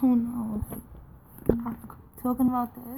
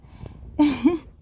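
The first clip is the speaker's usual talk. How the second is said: bursting out in a short high laugh; a quick embarrassed laugh; sudden high laugh; 6 semitones higher, 7 dB louder than usual. Real, not acted. Laughs at own crying confession.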